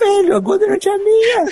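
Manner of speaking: very high, squealed indignant protest; hammed-up possessive outburst; mock outrage. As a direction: shoot the pitch right up in comic fury. Acting out outraged Golden Ranch owner.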